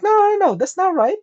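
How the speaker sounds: high-pitched mock friend voice; squeaky high friend voice. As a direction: play the friend squeaky and pushy. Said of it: "It's like".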